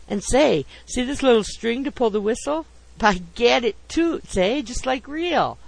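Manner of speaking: gleeful showing-off; boyishly thrilled. A narrator's reading. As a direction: marvel at the toy like a big kid. Man thrilled by toy whistle.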